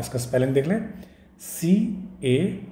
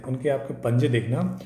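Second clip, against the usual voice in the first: usual speaking voice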